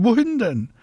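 put-on very high childish call. A narrator's reading. Marie asks Mama upstairs, calling out high. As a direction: call back up in protest. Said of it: "ruft Marie".

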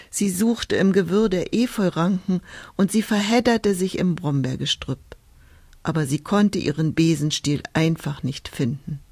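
ordinary delivery, nothing marked

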